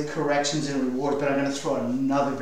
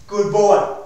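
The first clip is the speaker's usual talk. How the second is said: put-on louder, punchy Good boy; firm praise; 4 semitones higher, 7 dB louder than usual. Shown as a demonstration. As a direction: reward the dog out loud. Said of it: "Can you see the correct?".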